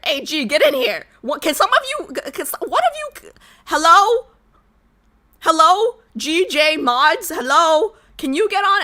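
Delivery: impatient hello calls; calling out. Real, not acted. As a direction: call out to get them on. Calling mods into Discord.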